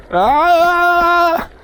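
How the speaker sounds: long high "aah"; drawn-out yell. Real, not acted. Running across the road, drawn-out yell. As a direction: let out a rising, held holler.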